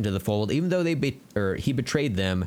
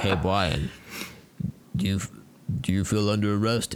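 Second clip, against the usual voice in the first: imitating Dom. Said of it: "pronounces R's as W".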